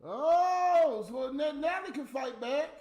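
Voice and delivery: loud, high outburst of surprise; a sudden loud cry of surprise at her comeback; surprised shout. Real, not acted. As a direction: burst out in disbelief. Surprised at Natalie's reply.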